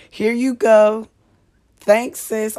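loudly fake-polite to the gossipy neighbor; mock gracious. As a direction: hand it over loud and sweet. Imagined autograph exchange.

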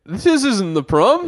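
high, loud, drawn-out realisation; comically baffled impression of the boyfriend; mock bewilderment. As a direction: play a caught-out dope, slowly. Acts out Billy caught out.

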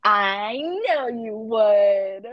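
slow, loud knowing tease; teasing drawl. Real, not acted. Teasing her friend about loving the book. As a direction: stretch it out knowingly.

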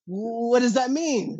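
high, slowing amazed wife; puzzled excitement from his wife; excited wife. Retold or imitated. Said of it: "And she's like".

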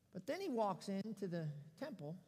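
ordinary talk, nothing marked

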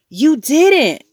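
high, loud disbelieving cry; shocked denial. A narrator's reading. Mother facing Fudge after his confession, shocked. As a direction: burst out in horrified disbelief.

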